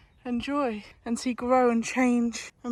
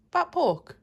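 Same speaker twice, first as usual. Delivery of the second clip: higher, louder cooing question; 6 semitones higher, 3 dB louder than usual. Real, not acted. Mother asking baby about pork, cooing.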